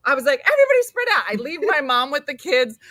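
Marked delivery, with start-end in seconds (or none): 0.4-1.2 high, loud urgent command; retold frantic order. Mom organising search for lost child, frantic command. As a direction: call it out to a whole group.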